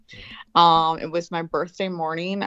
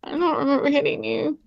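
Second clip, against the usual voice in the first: put-on bewildered kid; 8 semitones higher than usual. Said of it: "I'm like".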